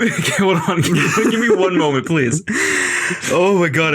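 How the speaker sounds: out of breath from laughing; gasping between words after laughter; after laughing hard. Real, not acted. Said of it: "I need to catch my breath".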